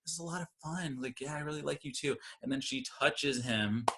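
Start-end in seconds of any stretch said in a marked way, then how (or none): 0.0-2.2 mock teenagers. Counselors pretending to be teens meeting, high and soft. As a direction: counselors acting out a sappy teen date. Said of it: "pretend to be two teenagers".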